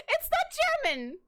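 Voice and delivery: high, laughing, slow it's not German; laughing insistence. Real, not acted. Laughing while insisting to friends, high.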